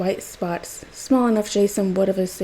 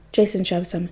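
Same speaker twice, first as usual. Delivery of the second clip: ordinary delivery, nothing marked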